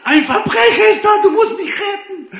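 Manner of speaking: high, loud, laughing mock panic; panicked wife. Wife Olla wakes husband at night, mock panicked squeal. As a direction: squeal like a terrified wife waking him. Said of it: "Und Olla so".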